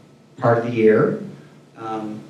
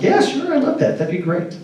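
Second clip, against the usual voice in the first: high-pitched, keen retold yes; eager agreement; 7 semitones higher than usual. Agreeing to let the date wear chaps, eager agreement.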